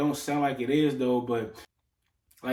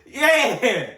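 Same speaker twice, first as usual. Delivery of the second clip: very high, loud squeal of amusement; real giggling outburst, pitched way up; laughing refusal; 13 semitones higher, 8 dB louder, much slower than usual. Real, not acted. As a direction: laugh it out high, drawing out the last words. Laughing reaction to replayed clip.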